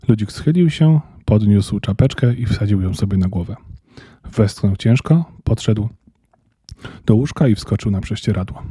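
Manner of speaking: ordinary delivery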